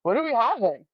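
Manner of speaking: chirpy oblivious doctor voice; breezy cheery greeting. Retold or imitated. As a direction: play the cheerful doctor who walks in unaware. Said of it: "and she goes".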